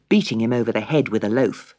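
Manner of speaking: ordinary delivery, nothing marked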